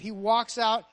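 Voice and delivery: ordinary delivery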